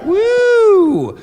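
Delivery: high, loud whoop to fire up the crowd; a real excited cheer. Real, not acted. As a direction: let out a big crowd-pumping woo. Host hyping the crowd.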